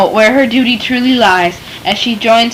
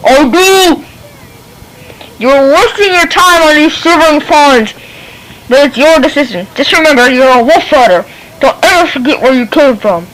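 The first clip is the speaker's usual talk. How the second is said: lightly gruff, firm warning; gruff wolf rider; 4 semitones higher, 3 dB louder than usual. Acted. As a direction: warn him gruffly. Gruff father warns his son.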